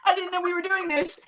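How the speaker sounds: mock flustered voice. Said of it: "I literally was like".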